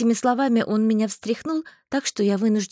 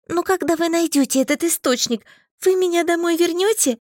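put-on higher, pleading girl's voice; pleading heroine; 8 semitones higher, 3 dB louder than usual. A narrator's reading. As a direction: plead to be taken home. (умоляюще, выше обычного)

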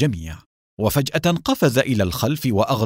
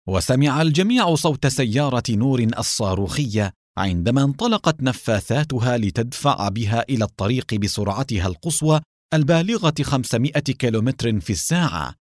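plain reading voice

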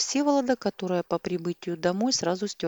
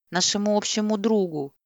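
louder, yelled answer; shouting; 4 semitones higher, 5 dB louder than usual. A narrator's reading. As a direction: yell it at him. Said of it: "заорала Рита". (кричит)

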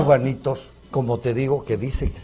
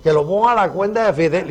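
lightly put-on raised, louder voice; imitating Fidel's followers; 4 semitones higher, 4 dB louder than usual. Bearded diner answers the maître d', lightly imitating Fidel. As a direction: mimic a self-important Fidel imitator. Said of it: "imitando al gran Fidel".

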